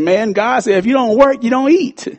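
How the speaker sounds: high, pointed rising admonition; preaching at him. Retold or imitated. Pastor retells quoting scripture to lazy friend, pointed rebuke. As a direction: quote the verse at him like a rebuke. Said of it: "I said".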